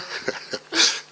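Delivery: quiet unvoiced chuckle; a breathless, soft chuckle; soft laugh. Real, not acted. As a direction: laugh softly at the memory. Recalls a funny moment.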